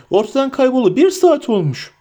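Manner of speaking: high-pitched, agitated outcry; alarmed cry. A narrator's reading. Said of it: "diye bağırdı Sam". (telaşla, tiz sesle bağırarak)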